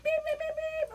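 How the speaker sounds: high, held beeps; imitated car horns. Storyteller acting out cars honking at the gorilla, high mimicked beeps. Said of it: "Cars are like".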